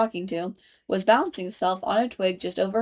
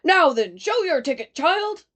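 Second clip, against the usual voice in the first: louder, higher impatient demand; stern guard; 8 semitones higher, 4 dB louder than usual. A narrator's reading. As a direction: bark at the child for her ticket. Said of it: "looking angrily at Alice".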